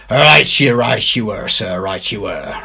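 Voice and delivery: a fawning pirate quick to agree; Silver's pirate voice. Acted. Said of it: "replied Silver".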